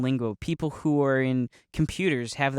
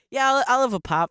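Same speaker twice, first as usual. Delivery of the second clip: raised, high-pitched mock ordering; acted casual order; 9 semitones higher than usual. To locals who say Coke, high and casual. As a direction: order lightly in a higher voice.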